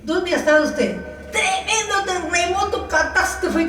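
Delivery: lightly voiced indignant woman, incredulous; annoyed old lady. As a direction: play the irritated señora scolding.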